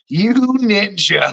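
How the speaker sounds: slow, stretched YOU NINJA; drawn-out teasing. Retold or imitated. Catching a friend trying to get a rise, drawn-out playful tease. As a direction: draw out "you ninja" with a laugh.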